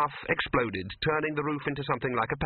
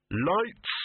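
commanding call; 5 semitones higher than usual. A narrator's reading. Victor commands the scene.

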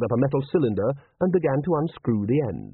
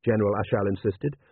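usual speaking voice

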